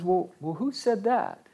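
high-pitched mock puzzlement; puzzled old friend. Second guy in the memory joke asking his friend, high and puzzled. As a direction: ask it high, as a bewildered character.

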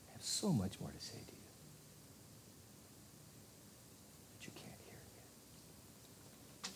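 Jesus whispering a gentle promise; whispered. Retold or imitated. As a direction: whisper it tenderly, like a secret promise. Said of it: "But Jesus says".

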